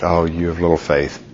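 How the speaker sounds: sighing over a friend's weak faith; gentle rebuke. Acted. Jesus gently chides Peter.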